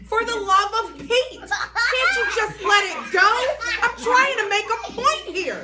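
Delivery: high, very loud frustrated yell; exasperated shout. Acted. Junie yelling at Mister Scary in frustration, very loud. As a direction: blow up at him.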